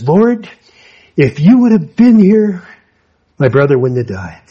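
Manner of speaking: reproachful Martha, high and loud; Martha's reproach. Retold or imitated. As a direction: cry it out in reproach. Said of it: "and she says".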